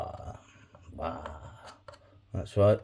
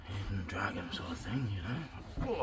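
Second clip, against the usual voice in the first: whisper; 8 dB quieter than usual. Acted. Kneeling, joking martial pose.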